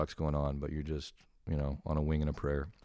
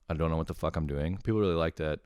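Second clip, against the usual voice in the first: usual speaking voice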